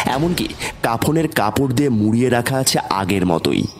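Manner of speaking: ordinary delivery, nothing marked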